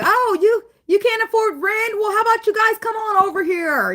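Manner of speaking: high, loud sugary neighbor voice; high sweet welcoming neighbor; generous neighbor. Retold or imitated. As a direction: sing it out like a kind host. Voiced generous neighbor offering home.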